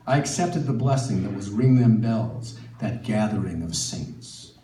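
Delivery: ordinary delivery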